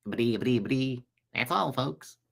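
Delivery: stuttering cartoon sign-off voice, imitating; cartoon pig sign-off. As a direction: stammer it like the old cartoon pig. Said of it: "Mel Blanc did all those voices".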